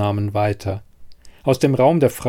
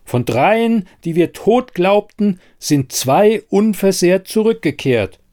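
high, loud announcement; a villager announces the miracle, high and loud; calling out; 8 semitones higher, 4 dB louder than usual. Acted. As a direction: proclaim it to the whole hall. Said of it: "rief plötzlich jemand".

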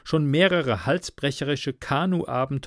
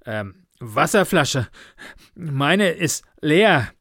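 slow, breath-broken phrases; winded and halting; almost gasping; 4 semitones higher, 5 dB louder, slower than usual. A narrator's reading. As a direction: gasp between the words. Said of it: "er schon fast japsend hinzufügte".

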